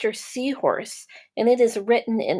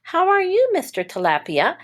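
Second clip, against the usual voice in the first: greeting that jumps much higher on how are you; friendly greeting; 11 semitones higher than usual. Acted. Mister Seahorse calling to a new fish, bright and high. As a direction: sing out a bright hello.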